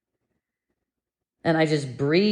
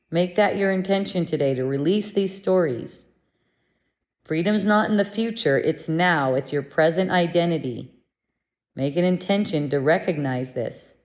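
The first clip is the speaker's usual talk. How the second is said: normal talking voice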